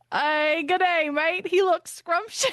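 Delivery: a light, hammed-up Australian accent. Friends beg him for his best Australian accent, lightly hammed-up Australian. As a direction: say it like a cartoon Aussie, but only a hint. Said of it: "your best Australian accent".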